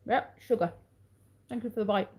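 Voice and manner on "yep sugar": normal talking voice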